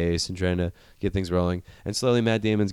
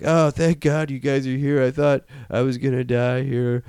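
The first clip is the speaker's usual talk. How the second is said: higher, put-on voice of the rescued man; mock tearful relief; 5 semitones higher than usual. Stranded astronaut greeting his rescuers, in mock desperate relief. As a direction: imitate overwhelmed relief, hammed up.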